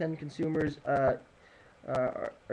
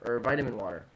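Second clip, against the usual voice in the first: ordinary delivery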